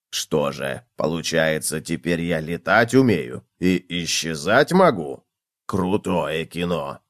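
low, soft, slow wonder; delighted cockroach. A narrator's reading. Cockroach discovers he can fly, awed delight. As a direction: marvel quietly in a deep drawn-out voice. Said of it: "восторженно сказал".

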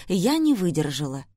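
plain reading voice

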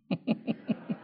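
quiet airy chuckle; soft breathy laugh. Real, not acted. Preacher laughing at his own defensiveness, soft breathy chuckle. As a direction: laugh under your breath at yourself.

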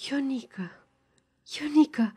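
hushed, tearful repeated name, falling; through tears. A narrator's reading. Girl clinging to her lover, softly through tears. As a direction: breathe the name out, mildly tearful. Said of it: "printre lăcrimi".

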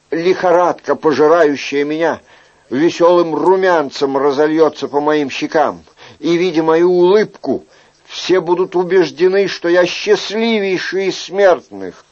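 put-on impassioned young courtier. Young man vows to look happy at court, impassioned. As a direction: build eagerly, voice held high.

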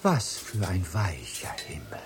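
hushed whisper; intimate whisper. Acted. Lover whispering to Lena, dreamy whisper.

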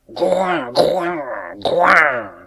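high, loud animal grunts in a row; three loud falling yelps; bear's pained squeals. A narrator's reading. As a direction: squeal like a beast being beaten. Bear cries out while beaten.

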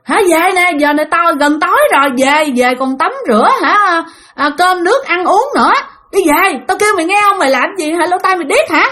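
high, insistent retold yelling; annoyed calling. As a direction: nag a friend who won't listen. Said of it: "Cái bà ngoại hỏi rằng á".

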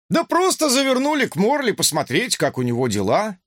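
нервный высокий голос громилы; пищит нервно, оправдываясь; высокий голос Рохли. Acted. (нервный писклявый голос)

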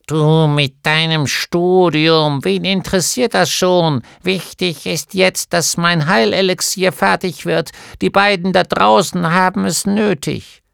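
put-on bossy old healer brushing off her husband; resolute gnome wife. A narrator's reading. Gnome wife voiced in story. (Gnomenweib fährt ihren Mann an, höher und lauter)

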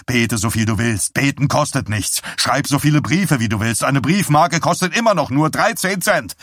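starting firm, climbing to a high shout; building angry tirade. A narrator's reading. Husband laying down the law to his wife, mounting fury. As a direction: build from scorn to furious shouting.

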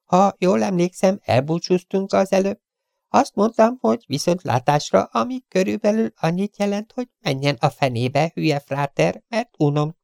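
high, curt, insolent gangster voice; rude Chiefen. Acted. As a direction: sneer the intruder off. (magas, pimasz, goromba hang)

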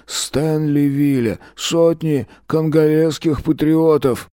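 lightly voiced old party leader; a hint of a droning propaganda speech; Soviet news-speech voice. A narrator's reading. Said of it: "бурчащим, причмокивающим... голосом".

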